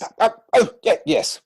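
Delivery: high, loud startled stammer; a yelp of surprise that fades to a breathy yes; flustered stammer. Acted. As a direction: caught out, stumble over the words. Caught off guard, flustered stammer.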